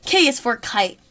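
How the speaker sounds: plain reading voice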